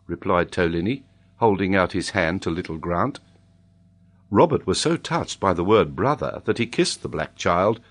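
ordinary delivery

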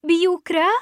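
high, loud astonished question; alarmed exclamation; startled cry. A narrator's reading. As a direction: cry out in shocked recognition. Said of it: "فصرخت أنا وميغان".

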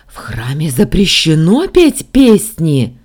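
loud, drawn-out angry ban; slow, forceful, furious scolding; hissing madman. A narrator's reading. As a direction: rebuke them like a crazed zealot. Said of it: "зашипел сумасшедший".

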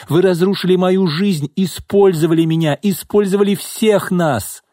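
impassioned high-pitched tirade; bitter accusation. A narrator's reading. Betrayed secretary denounces her boss, bitterly accusing.

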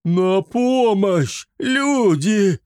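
high, drawn-out panicked cry for help; hysterical yell. A narrator's reading. Drunk Torval screams for help in panic, hysterical. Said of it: "истерично завопил".

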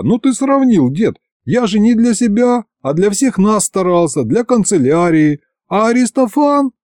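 high, indignant self-defence. A narrator's reading. Boss insists it was for the office, indignant. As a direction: protest with pitch kept up high. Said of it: "возмутился я".

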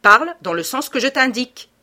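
domineering demand; commanding. A narrator's reading. Lupin orders the youth to obey.

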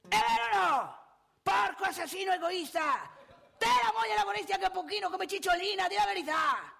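high, loud comic interrogation; accusa gridata. Acted. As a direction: berate him and demand a confession. Aggredisce comicamente uno del pubblico. (invettiva urlata, acuta e teatrale)

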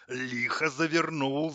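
slow, choked complaint; strangled. A narrator's reading. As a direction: squeeze the words out as if throttled. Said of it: "сдавленно пожаловался кран". (сдавленно, с натугой)

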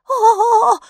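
very high, loud drawn-out squeal; creature's loud squeal. A narrator's reading. Creature in the lamp shrinks from Kaapo, loud high squeal. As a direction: squeal out at the top of the voice. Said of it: "взвизгнуло существо".

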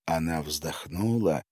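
ordinary delivery

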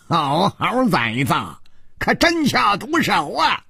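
high-pitched mock cursing; laughing scolding. An old comrade curses the attackers' vicious moves, jokingly and high. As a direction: scold them with jocular outrage. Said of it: "只听戴独行笑骂道".

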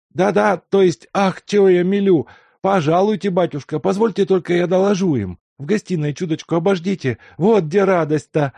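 put-on high, loud old man scolding himself; an old servant overjoyed and flustered; fussing old servant. A narrator's reading.

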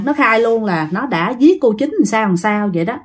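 usual speaking voice

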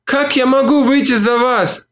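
high moaning woman's voice. A narrator's reading. Olivia answers Mark's proposal, moaning high. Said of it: "простонала она".

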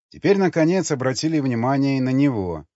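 plain reading voice

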